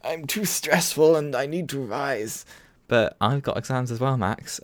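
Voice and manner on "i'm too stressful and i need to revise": high whiny mock excuse voice; whiny Max impression. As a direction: whine it like a sulky student skipping work. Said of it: "making an excuse".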